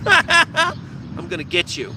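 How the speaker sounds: slow, high-pitched creepy singsong threat; gleeful, drawn-out mock menace; mock maniac. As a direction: taunt like a cartoon villain stalking prey. Acting gleeful gopher shooter.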